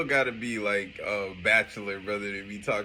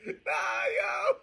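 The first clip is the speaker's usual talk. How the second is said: ordinary talk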